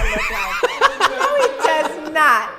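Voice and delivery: laughing through the denial; giggling no, he does not. Real, not acted.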